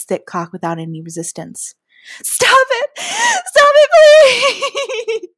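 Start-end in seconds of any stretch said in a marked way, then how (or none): 2.2-5.3 very high, loud desperate scream; shrieking, pleading protest; screamed plea. A narrator's reading. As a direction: scream it in panic, building to a drawn-out cry. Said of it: "I screamed".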